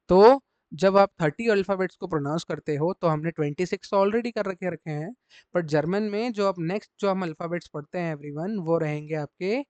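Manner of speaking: ordinary talk, nothing marked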